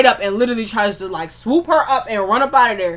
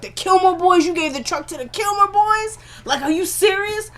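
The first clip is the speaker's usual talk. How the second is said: high, incredulous outburst; can't believe what he just heard; stunned disbelief; 9 semitones higher than usual. Retold or imitated. J B hearing who got the truck, high and incredulous.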